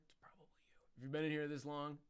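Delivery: breathy whisper to the audience. Acted. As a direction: lean in and whisper it. Whispering to viewers.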